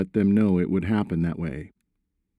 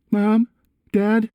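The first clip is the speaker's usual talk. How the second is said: high, louder calling out; raised voices; 14 semitones higher, 5 dB louder than usual. A narrator's reading. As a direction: call out urgently in a much higher voice. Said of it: "they said simultaneously with raised voices".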